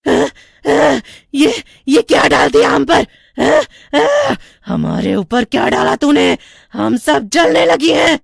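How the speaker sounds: high, loud shrieking witches; burning witches. A narrator's reading. Witches screaming as the holy ash burns them. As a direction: shriek in agony as you burn. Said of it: "चुड़ैलें जलने लगती हैं".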